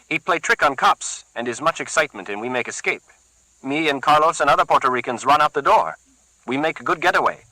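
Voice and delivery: a keen henchman keen to use the cleaver; Carlos, eager thug. Acted. Carlos offering his boss a fix, eager. Luis higher voice.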